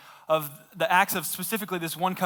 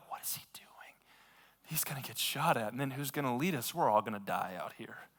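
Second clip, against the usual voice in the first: whisper; 6 semitones lower, 9 dB quieter than usual. Retold or imitated. His soldiers murmur in disbelief.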